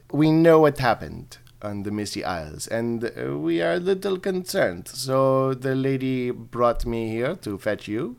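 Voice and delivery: hammed-up French-accented elf commander; bad French accent. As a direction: explain it officiously in a badly faked French accent. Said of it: "they're gonna be really bad French accents".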